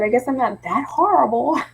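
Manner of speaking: ordinary talk, nothing marked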